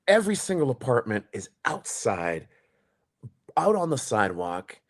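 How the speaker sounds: ordinary delivery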